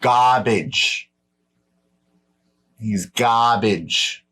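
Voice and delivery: put-on slow, drawn-out Eastern American accent. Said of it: "I'm using an Eastern, Eastern American accent".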